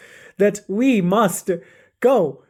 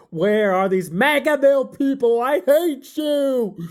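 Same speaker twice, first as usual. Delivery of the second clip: angry taunting; 5 semitones higher than usual. A narrator's reading. Harry alone outside, taunting the Magaville people, angry. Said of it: "angry at the Magavilles, taunting them".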